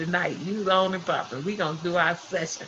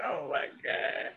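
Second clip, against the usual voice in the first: breathless, whispered disbelief; stunned whisper; 9 dB quieter, slower than usual. Retold or imitated. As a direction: barely breathe it out, slow and stunned. Stunned reaction retold.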